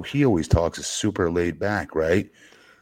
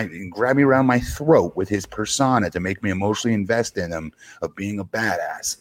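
normal talking voice

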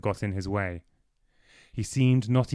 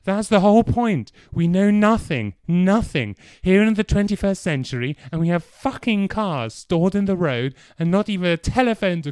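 angry shouting, higher and louder, straining to stay calm; crescendoing with a tremor; 8 semitones higher, 5 dB louder than usual. Acted. Clive rants about the blackout, shouting with a tremor. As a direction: rage barely held back. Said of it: "Clive's voice began to crescendo... tremor".

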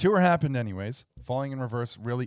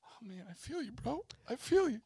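sympathetic bro; 11 semitones higher, 7 dB quieter than usual. Retold or imitated. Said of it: "I'm like".

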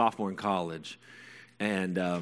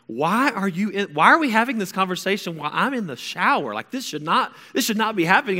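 acting out a flustered, embarrassed teen's outrage; mortified protest; 11 semitones higher, 7 dB louder than usual. Teen in the shower objects to mom's questions, mortified protest. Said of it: "I remember feeling so awkward".

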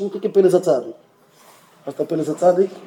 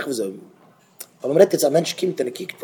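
ordinary talk, nothing marked

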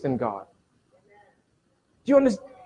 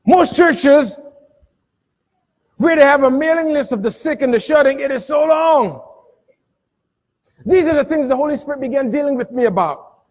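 high, forceful sermon delivery; raised, emphatic preacher's voice; fired-up preaching; 6 semitones higher, 4 dB louder than usual. Real, not acted. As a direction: preach it out to the congregation.

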